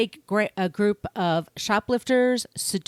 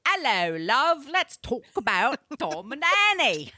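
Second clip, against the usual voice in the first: higher, laughing British accent attempt; 5 semitones higher than usual. Host greets listeners in a hammed-up British accent. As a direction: greet them like a London barmaid in a British accent. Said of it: "my attempt at a British accent".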